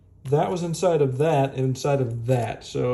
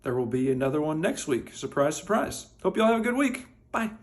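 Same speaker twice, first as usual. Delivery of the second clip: usual speaking voice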